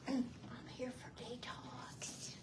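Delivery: slow, barely audible whisper; timid whisper. Retold or imitated. Newcomer at the detox buzzer, timidly whispering.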